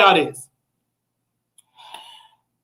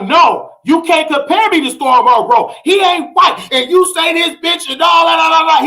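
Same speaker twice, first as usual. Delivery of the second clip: emotional rant; 6 semitones higher than usual. Retold or imitated. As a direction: rant indignantly in his voice, pitch climbing. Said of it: "He going on his emotional rant".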